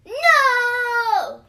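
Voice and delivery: put-on piercing high scream of a furious toddler. A narrator's reading. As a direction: scream it like a tantrum. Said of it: "yelled Chucky".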